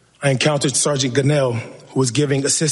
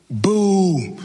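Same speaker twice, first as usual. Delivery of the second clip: higher, drawn-out boo at a restrained volume; retold crowd boo; 5 semitones higher than usual. Officer quoting the crowd that joined in screaming, a mild mock boo. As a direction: imitate a jeering crowd without really screaming. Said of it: "joined in screaming".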